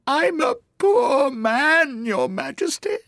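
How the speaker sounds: very high, slow, tearful plea; miserable. Acted. Hatter begging the King on one knee, miserable. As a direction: wail pitifully, drawn out, then soften. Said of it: "The miserable Hatter dropped his teacup".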